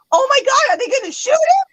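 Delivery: high-pitched, panicky retold cry; retold near-tearful panic. Retelling fear that police would shoot, high-pitched panic. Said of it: "was ready to cry".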